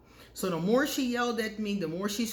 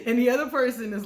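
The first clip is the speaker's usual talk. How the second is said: said through laughter, higher and louder; laughing; 5 semitones higher, 7 dB louder, faster than usual. Real, not acted. Vlogger telling viewers about Aquarius, laughing. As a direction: laugh as you set up the quote.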